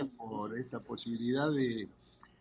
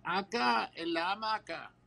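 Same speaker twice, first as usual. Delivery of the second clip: a mild acted call, pitched up; calling back; 6 semitones higher, slower than usual. Drunk neighbour answering from the yard, calling back lightly. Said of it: "del fondo siente que le gritan".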